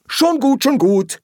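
high, loud, hurried brush-off; interrupting. Acted. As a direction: cut them off impatiently. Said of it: "fiel ihm Schnapper ins Wort".

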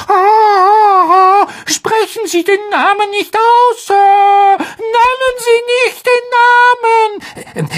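sehr hoher, klagender Aufschrei des Elfen; flehend, mit Wehlaut in der Stimme; stöhnend, verängstigt. Acted. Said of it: "schlug die Hände gegen seine Fledermausohren und stöhnte". (verängstigter Hauself fleht Harry an, klagend und sehr hoch)

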